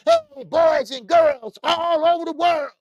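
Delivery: loud, slow, high announcer-style greeting; Money Brown. Retold or imitated. Mimicking a boxer's catchphrase for viewers, loud, high and slow. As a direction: boom it out like a showman hyping the crowd. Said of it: "Look at Money Brown".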